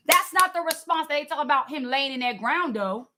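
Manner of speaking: ordinary talk, nothing marked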